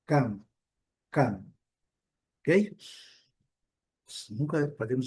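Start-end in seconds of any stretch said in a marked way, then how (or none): 0.1-1.5 "come" with a short vowel, ending on closed lips; "come" finished with a fully closed M. Shown as a demonstration. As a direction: say "come" and shut the mouth on the M. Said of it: "com a boca fechada ao final".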